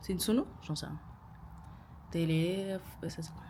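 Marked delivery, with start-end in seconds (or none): none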